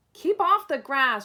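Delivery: loud, high angry shout. Acted. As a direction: bark the order at him. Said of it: "shouted Hank".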